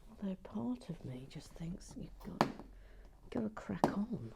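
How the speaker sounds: soft whisper to herself; whispered aside. Real, not acted. Whispering an aside about cracking on. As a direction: murmur it as a private thought.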